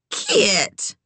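very high, shocked reproach; scandalized one-word telling-off; scolding. A narrator's reading. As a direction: scold her by name, pitch leaping up. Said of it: "Eleanor scolded".

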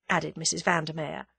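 ordinary delivery, nothing marked

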